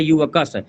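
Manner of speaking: युवकस said clearly with a k sound, not श; teacher stressing the क in युवकस; pronounce with k. Shown as a demonstration. Teacher correcting students, युवकस said with a clear क. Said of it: "श नहीं है".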